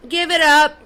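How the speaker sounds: second "Give it up" thrown harder. Real, not acted. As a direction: snap it out the second time. Mocking dismissal, said twice.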